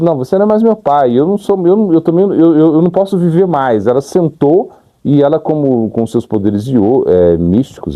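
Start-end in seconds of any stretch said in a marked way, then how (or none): 0.0-3.9 mildly indignant retold outburst, pitched higher; brava, reencenada. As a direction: retell an upset daughter disowning her father, higher but not shouted. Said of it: "ela ficou tão brava que ela falou assim". (levemente brava, em tom mais agudo, imitando)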